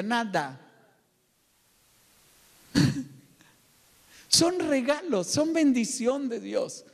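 ordinary talk, nothing marked

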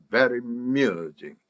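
slow "Very amusing", the a absorbed; linked sounds. Shown as a demonstration. Teacher repeats the model for learners, "Very amusing" linked. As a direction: stretch "Very amusing" as one joined piece. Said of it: "이렇게 바로 나옵니다".

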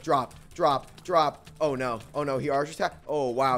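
normal talking voice

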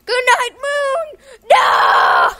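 screaming at the game, loud and high. Real, not acted. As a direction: scream it at the top of your lungs. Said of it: "I'm, like, screaming at the".

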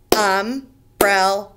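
"Umbrella" slow, each syllable apart: um-brel-la; syllables slowly split. Shown as a demonstration. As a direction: clap out "Umbrella" beat by beat. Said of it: "How many syllables?".

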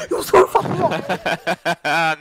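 slow stretched yell; drawn-out shout. Acted. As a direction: stretch each word out into a yell.

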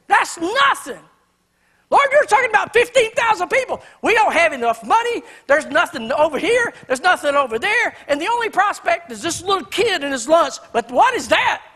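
high, loud scoffing complaint; sarcastic cynic. Retold or imitated. Cynical Andrew scoffing at Jesus about the crowd, sarcastic and loud. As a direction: scoff as if the idea is ridiculous. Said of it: "I think he does it sarcastically".

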